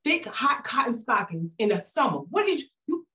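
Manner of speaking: mock-exasperated husband, climbing; husband scolding her stockings in summer, rising squeal; husband's disbelief. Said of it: "He's like".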